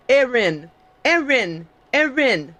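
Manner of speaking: Erin as EH-rin, falling each time; correct name pronunciation. Shown as a demonstration. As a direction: demonstrate Erin with an open eh, then rin. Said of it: "It's so easy".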